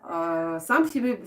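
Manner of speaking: ordinary talk, nothing marked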